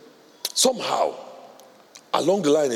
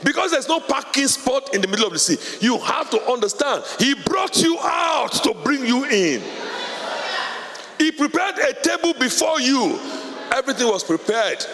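preaching; 10 semitones higher than usual. Acted. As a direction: preach it out to the church with fire. Preaching at full force.

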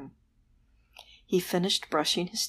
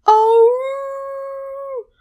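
very high, loud cry; werewolf exclamation; 8 dB louder than usual. Acted. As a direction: yelp like a startled creature. Werewolf arrives.

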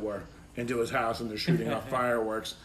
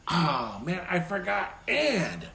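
higher, drawn-out retold dismay; mock exasperation, slow and higher; exasperated realization; 6 semitones higher, slower than usual. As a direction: slowly groan at your own slip, acting it out. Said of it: "I read it and go".

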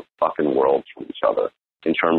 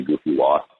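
ordinary delivery

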